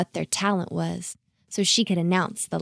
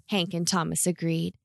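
usual speaking voice

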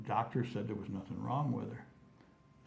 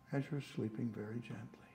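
soft hypnotic whisper; 7 dB quieter than usual. Shown as a demonstration. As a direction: breathe the words gently. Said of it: "would you mind testing that on us".

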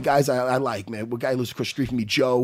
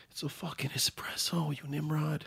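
much softer muttered insult; under his breath; 3 semitones lower, 18 dB quieter than usual. Retold or imitated. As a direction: say it so he can't hear. Muttered retort to himself.

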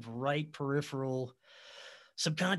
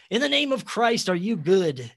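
high, loud demand in the name of Christ; fearful challenge; 5 semitones higher, 10 dB louder than usual. Retold or imitated. Frightened man testing the entity, high and loud. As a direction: challenge the spirit like an exorcist.

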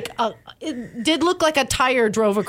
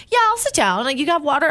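eager acceptance; 6 semitones higher than usual. Retold or imitated. Retells accepting the valet's offer.

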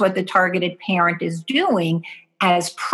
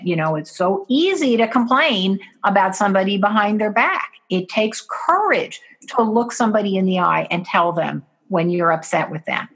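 normal talking voice

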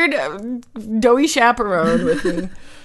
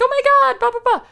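high gushing to a friend; 4 dB louder, much faster than usual. Retold or imitated. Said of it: "you were like".

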